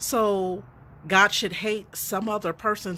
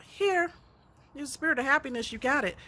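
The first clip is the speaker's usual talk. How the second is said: much higher, playful voice handing over a gift; a lightly cheery announcer handing out happiness; mock gift-giver; 7 semitones higher than usual. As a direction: act out happiness being dropped in your lap.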